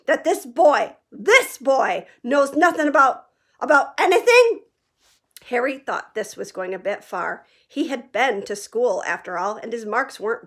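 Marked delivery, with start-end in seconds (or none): 0.1-4.6 loud, climbing to a high outraged peak; growled. A narrator's reading. Hagrid growling at the Dursleys about Harry. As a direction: build to disbelieving outrage. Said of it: "he growled at the Dursleys".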